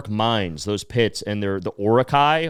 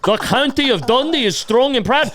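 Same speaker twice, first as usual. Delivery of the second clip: hammed-up Scottish piper, much higher and louder; mock Scottish accent; 11 semitones higher, 5 dB louder than usual. Mocking the street piper in a high, loud Scottish accent. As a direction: announce it proudly in a silly Scottish voice. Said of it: "And they're like".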